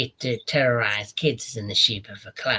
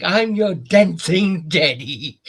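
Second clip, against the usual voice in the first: high, loud, slow creepy clown croon; imitating Pennywise, high and drawn out; Pennywise one-liner; 6 semitones higher, 8 dB louder, slower than usual. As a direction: stretch it out like the clown. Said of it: "it's the one-liners".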